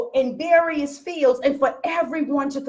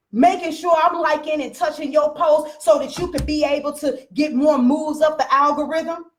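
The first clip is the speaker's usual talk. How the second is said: ordinary talk, nothing marked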